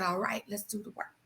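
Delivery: soft whisper of reassurance. Retold or imitated. God answers the wife in a whisper. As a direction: whisper it like a promise.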